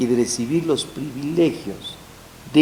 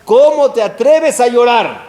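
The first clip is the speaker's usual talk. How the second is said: raised, higher, accusing; indignant rebuke; 9 semitones higher, 6 dB louder than usual. Retold or imitated. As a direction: scold him for daring to cry. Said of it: "Y dice ahí".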